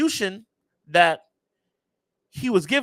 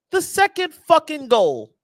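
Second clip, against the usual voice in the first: higher, louder and slow, drawn out in frustration; exasperated outburst; 8 semitones higher, 5 dB louder than usual. Real, not acted. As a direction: spit it out in disbelief at the goal. Ranting about conceded goal.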